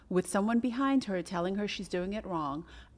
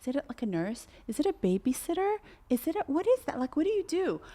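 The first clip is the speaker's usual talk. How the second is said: confused questions; 6 semitones higher than usual. Retold or imitated. People were like.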